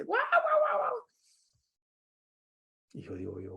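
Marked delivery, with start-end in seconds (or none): 0.0-0.9 high squealing mock "guau, guau" laugh; playful imitation of a delighted laugh; imitating wife's laugh. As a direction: squeal it way up like she's cracking up. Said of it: "yo la oigo a ella riéndose".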